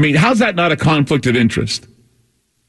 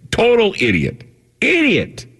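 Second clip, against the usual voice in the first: scornful jab; 8 semitones higher, slower than usual. Real, not acted. Host ranting about Gates.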